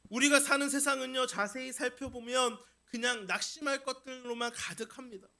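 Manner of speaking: normal talking voice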